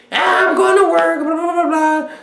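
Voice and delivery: very high, loud falsetto babble; exaggerated high-pitched drone; mock woman's chatter. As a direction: imitate her answer as squeaky blather. Mimics the woman's answer.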